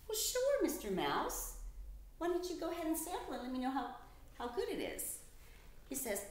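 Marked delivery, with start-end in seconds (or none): 0.1-5.2 cheery reply. Acted. Narrator answers mouse brightly.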